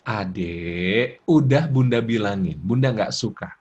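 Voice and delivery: low, slow, controlled mother voice; steady intonation. Shown as a demonstration. Mother telling child she disapproves, steady and calm. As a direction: correct the child without raising your voice. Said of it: "ya, intonasinya tetap".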